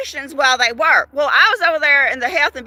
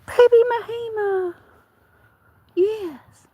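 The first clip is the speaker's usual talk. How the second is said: high pet voice; 8 semitones higher, slower than usual. Pretend. Pet voice continues.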